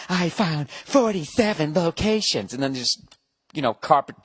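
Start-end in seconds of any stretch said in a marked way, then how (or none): 0.1-2.5 higher, slow, lightly robotic; phone assistant voice. Retold or imitated. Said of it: "He's like".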